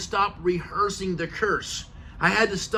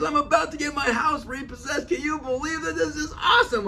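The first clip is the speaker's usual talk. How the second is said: much higher, giddy disbelief; mock a friend delighted by disaster; hysterically happy friend; 10 semitones higher than usual. Friend laughing about losing his house, giddy and high. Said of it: "He was just, like, hysterical".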